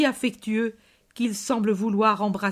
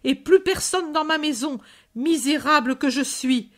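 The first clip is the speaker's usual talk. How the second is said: shrill lament of a bereaved mother; shrill woman's cry; 3 semitones higher than usual. Acted. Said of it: "Une voix stridente de femme crie".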